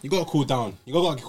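ordinary delivery

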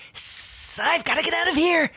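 a hiss, then a high, squeaky scared snake. Acted. Snake fleeing the gnome, hiss and high squeak. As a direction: play a spooked little snake fleeing. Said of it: "And the snake goes".

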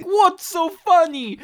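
loud, high, slow put-on outburst; hammed-up offended criminal; mock indignation. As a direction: draw it out in comic outrage. Voicing offended criminals storming out.

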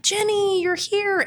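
high, delighted cry of welcome; light up at seeing your sister; excited greeting. Retold or imitated. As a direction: sister greeting Jenny, thrilled. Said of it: "you're like".

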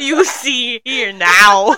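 slow, loud put-on Southern accent; mock Florida accent. Shown as a demonstration. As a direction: stretch it out in a hammed-up Florida accent. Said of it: "That was so Minnesotan".

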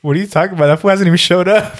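amused roasting. Real, not acted. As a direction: tease a friend, breaking into laughter. Roasting friend's mustache amid laughter.

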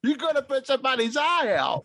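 put-on high, fretful old lady voice; old grandma voice. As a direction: nag like a worried granny. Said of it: "I sound like the old grandma now".

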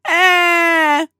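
short high crying wail; a sudden high whimpering cry. Retold or imitated. Daughter finishing her list of woes with a crying wail. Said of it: "that's pretty much how I sounded".

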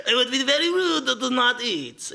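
French chef insisting gently; mock French chef. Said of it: "this is a French chef".

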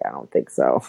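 low, dismissive no thanks; skeptical refusal. Retold or imitated. Refusing steroids for her fourteen-year-old son, low and dismissive. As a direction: brush it off low and dry. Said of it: "And I was like".

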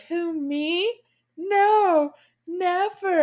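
high, coy denial; mock innocent Lestat. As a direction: play it falsely innocent. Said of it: "And Lestat's like".

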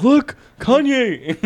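high, slow, through laughter; laughing. Retold or imitated. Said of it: "He's like".

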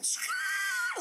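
high-pitched tire screech; piercing screech of a skidding car. Retold or imitated. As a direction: imitate brakes squealing to a halt. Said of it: "comes to a screeching halt".

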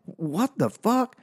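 high, stunned what-the-fuck; squeaky stunned disbelief; shocked disbelief. Retold or imitated. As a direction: reel back in shock. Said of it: "And so I'm just like".

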